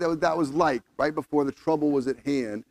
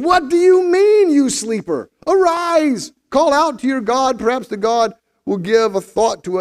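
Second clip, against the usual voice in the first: high, loud, drawn-out cry of rebuke; alarmed captain rousing a sleeper; 9 semitones higher, 6 dB louder than usual. Acted. Captain to sleeping Jonah on a storm-tossed ship, loud urgent rousing. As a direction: shout him awake urgently.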